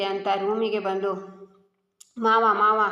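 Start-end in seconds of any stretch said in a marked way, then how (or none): 2.2-2.8 loud call toward a closed room. Acted. Daughter-in-law calling her hidden father-in-law out, a loud call. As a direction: call him out of the room, louder.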